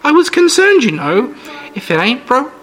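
put-on sweet, creepy old lady.

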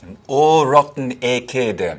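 a light West African Creole accent, copying the clip. Shown as a demonstration. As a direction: repeat the line in a mild Sierra Leone Creole accent. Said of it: "DiCaprio is speaking a West African Creole".

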